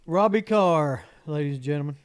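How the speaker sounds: loud showman introduction; announcer intro. Acted. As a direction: present him like a host.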